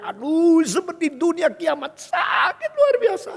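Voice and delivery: high, loud wail of agony, imitating a sufferer; acted scream of unbearable pain. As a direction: cry out in dramatized agony, stretching the last words. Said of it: "Lalu dia teriak-teriak".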